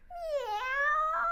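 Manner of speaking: high loud cat meow. Acted. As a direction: let out a big meow. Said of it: "meowed loudly".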